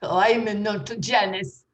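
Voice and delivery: ordinary talk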